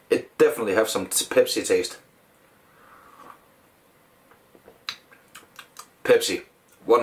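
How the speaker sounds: speaking through fingers; muffled by hand; hand over mouth. Real, not acted. Seen 4: Glass held low at chest; other hand covers mouth from 1.9s.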